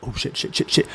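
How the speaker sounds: hushed, voiceless panicked muttering; mock panic. Civilian acting out being under fire, in a hushed mock panic. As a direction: play panic under your breath, very softly. Imagined panic as bullets fly past.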